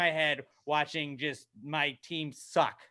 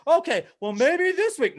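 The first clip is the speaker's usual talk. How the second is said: high, loud wishful squeak; naive hope; 11 semitones higher, 6 dB louder than usual. Retold or imitated. Fan convincing himself about next week, mock hopeful. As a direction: talk yourself back into it, hammed up.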